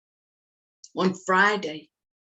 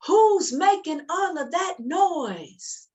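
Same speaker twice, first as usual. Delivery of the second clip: high, slow demand; mother calling out; 10 semitones higher, slower than usual. Acted. Mother hearing noise upstairs, calling out high. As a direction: call up the stairs like a puzzled mum. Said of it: "what she said".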